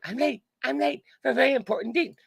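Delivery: very high, frantic little voice; high-pitched hammed-up fretting. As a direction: squeak it out in a hurried panic.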